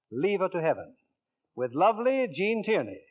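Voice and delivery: ordinary talk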